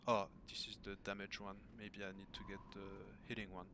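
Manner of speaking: ordinary talk, nothing marked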